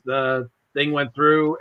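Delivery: usual speaking voice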